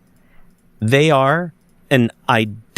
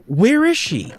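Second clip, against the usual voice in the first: retold mild scream; 9 semitones higher than usual. Friend recognizes the girl in the picture, retold high-pitched cry. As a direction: imitate her panic in a raised, high voice. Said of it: "like, she screamed and she goes".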